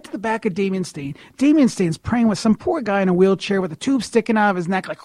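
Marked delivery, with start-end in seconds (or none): none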